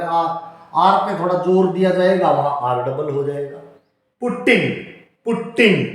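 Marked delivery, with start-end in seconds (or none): 4.2-5.9 पुटिंग with the t pressed hard; पुटिंग with a held, doubled t; pressed t in putting. Shown as a demonstration. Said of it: "टे पे जैसे दाब दिया जा रहा है".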